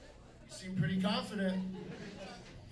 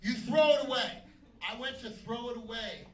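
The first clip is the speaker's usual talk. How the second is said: ordinary talk, nothing marked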